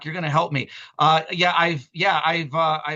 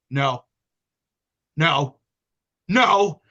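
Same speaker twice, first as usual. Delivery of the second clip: imitating scolding; 5 dB louder, much slower than usual. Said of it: "this is verbatim, say this".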